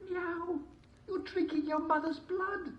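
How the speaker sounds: high meowing cat. A narrator's reading. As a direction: squeak it like a tattling cat. Cat voiced.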